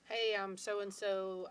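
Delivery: ordinary talk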